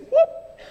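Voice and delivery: quick high yelp of excitement; a sudden squeal; high whoop. Real, not acted. Preacher catching himself before the key point, high whoop. Sudden exclamation mid-sermon.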